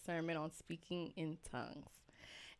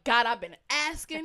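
a mildly indignant complaint to God, louder; half-joking frustration, pressing the point; mad at God; 4 semitones higher, 9 dB louder than usual. Retold or imitated. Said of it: "I'm mad now".

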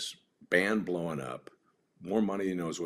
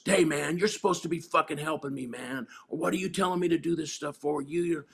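livid; 10 semitones higher, 4 dB louder than usual. Retold or imitated. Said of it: "the kid gets fucking livid".

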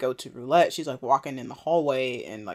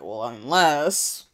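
slow suggestive tease; much slower than usual. Retold or imitated. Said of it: "Whisper is like".

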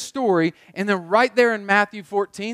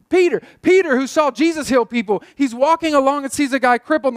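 excited build; 5 semitones higher, 4 dB louder than usual. Acted. Preacher builds to excited pitch.

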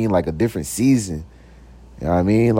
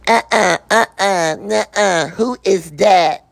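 sassy refusal; 9 semitones higher, slower than usual. Retold or imitated. Ratchet woman refusing.

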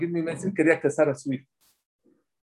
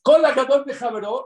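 "Kol hagadol mejaveró" said much higher with a rising end; raised quote; 10 semitones higher, 4 dB louder than usual. Shown as a demonstration. Rabbi quoting the Gemara to his class, pitched high. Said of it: "como dice la Gemara".

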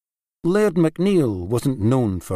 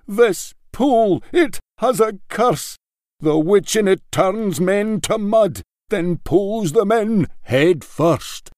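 much higher, louder, alarmed exclamation; exclaiming; 9 semitones higher, 3 dB louder than usual. A narrator's reading. Laird warning Niall of the cursed pool, high and loud.

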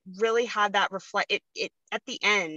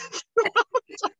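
a quick high laugh, louder; short high laugh; 4 dB louder than usual. Real, not acted. Laughing with the host about holding it together. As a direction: laugh after the joke. Laughs after her own joke.

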